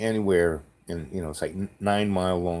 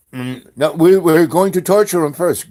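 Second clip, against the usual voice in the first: loud, high mock threat; insistent threat; 9 semitones higher, 12 dB louder than usual. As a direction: imitate a caller escalating, voice raised. Caller escalates threat on phone.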